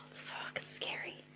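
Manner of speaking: whispered "it's fucking scary" on a sigh. Real, not acted. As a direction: breathe it out in a whisper.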